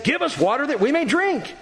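high-pitched complaint from a thirsty crowd; quarrelsome demand. A narrator's reading. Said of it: "the people quarreled with Moses".

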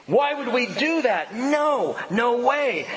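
high, incredulous outburst; emotional response. Acted. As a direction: balk at a ridiculous question. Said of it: "hearing the question raised in his own mind and going".

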